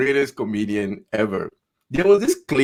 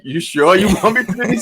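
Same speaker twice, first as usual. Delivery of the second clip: higher-pitched mock doubt; imagined comedian's reaction; 8 semitones higher than usual. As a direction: ask it as an incredulous pretend quote.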